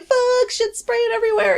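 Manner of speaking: loud, high panicked curse; retold panicked cursing. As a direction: shout as batter sprays everywhere. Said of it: "And I'm like".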